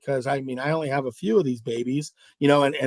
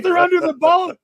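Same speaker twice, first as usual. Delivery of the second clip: high, louder mock exclamation; excited insistence; 12 semitones higher, 5 dB louder than usual. Partner reveals where the fish are, excitedly insistent. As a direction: imitate a giddy, insistent fishing partner.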